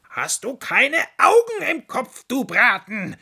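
very high and loud furious yelling; angry fox yelling at the rabbit. Acted. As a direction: bawl out the rabbit who knocked you over.

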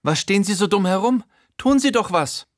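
high, fast, shrill-sounding screech at the gardener; shrieking. Acted. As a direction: scream the order in panic. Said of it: "kreischt sie in Richtung Gärtner". (kreischend, hoch und schnell)

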